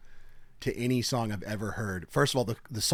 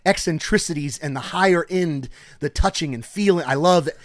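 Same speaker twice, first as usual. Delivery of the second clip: rapturous rant; 5 semitones higher, 7 dB louder than usual. Retold or imitated. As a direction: gush like a man in bliss over life. Retelling a man's rapturous rant.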